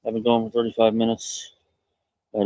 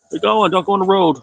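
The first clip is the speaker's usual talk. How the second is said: high, louder call after the animal; calling a pet; 8 semitones higher, 4 dB louder than usual. Real, not acted. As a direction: call it back from the road. Calling animal away from road.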